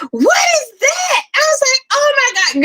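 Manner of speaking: high panicked shriek; screaming in fright. Retold or imitated. Woman alone at home screaming at a shadow. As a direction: scream it out, terrified of the thing on the floor. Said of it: "sitting here screaming like".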